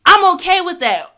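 normal talking voice

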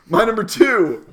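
high, loud, slow call; loud announcing. Acted. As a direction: announce it big. Countdown announcement.

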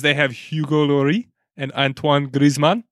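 names said in a hammed-up French accent; terrible French accent. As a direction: fake a bad French accent from school French. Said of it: "That was my French accent".

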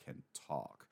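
drawn-out quiet wonder, lightly voiced; amazed Luffy. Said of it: "Luffy over and over is like".

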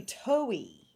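"toey" as TOE-ee with no R; R dropped. Shown as a demonstration. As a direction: say "toey" with the R softened away to nothing. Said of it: "turn the R into an H".

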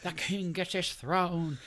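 high, slow mock royal bellow; ranting king voice. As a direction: play a crazed self-crowned king. Guy in Burger King crown raging.

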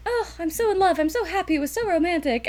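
mock a swooning newlywed; lovestruck girl. Imitating the deluded wife, high lovesick gush.

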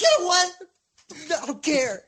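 exasperated brush-off; fed-up Jonah. Retold or imitated.

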